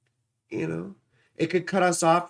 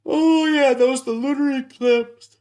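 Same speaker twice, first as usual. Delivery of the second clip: high and loud, suddenly remembering; loud exclamation; 7 semitones higher, 7 dB louder than usual. Real, not acted. Sudden loud recall, then excuses herself.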